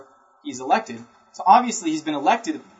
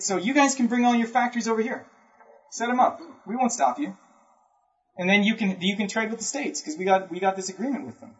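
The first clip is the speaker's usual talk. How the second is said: higher, lightly voiced persuasive pitch; Buchanan's sales pitch; 6 semitones higher than usual. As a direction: sell it to Britain, eager and inviting. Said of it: "So Buchanan says".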